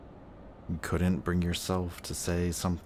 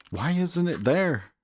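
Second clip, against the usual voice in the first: much higher, louder desperate outburst; frustrated cry; 11 semitones higher, 6 dB louder than usual. Acted. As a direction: break out in helpless frustration. Searching in vain for a trace.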